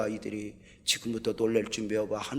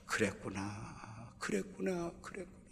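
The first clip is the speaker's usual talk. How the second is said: slow, much softer repetition, fading away; soft, moved; 12 dB quieter, slower than usual. Acted. Pastor moved by the elder's gratitude, softly fading murmur. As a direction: let it melt into a hushed murmur.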